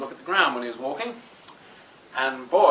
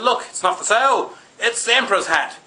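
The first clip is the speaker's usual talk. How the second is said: higher-pitched, mildly exasperated correction; exasperated insistence; 6 semitones higher, 3 dB louder than usual. Acted. Soldier correcting William about the hat on the pole, exasperated.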